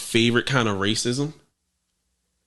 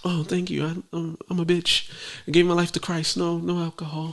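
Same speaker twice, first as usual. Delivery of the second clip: quiet; 2 semitones higher, 7 dB quieter than usual. Retold or imitated. Retold polite refusal of champagne.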